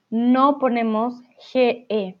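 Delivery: ordinary delivery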